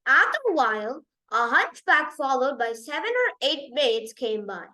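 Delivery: plain reading voice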